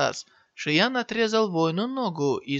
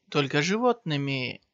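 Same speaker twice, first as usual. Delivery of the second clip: plain reading voice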